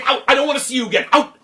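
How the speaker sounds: high, loud, fast banishment; furious king. Acted. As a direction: throw her out in a rage. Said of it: "He got furious".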